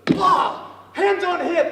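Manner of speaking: normal talking voice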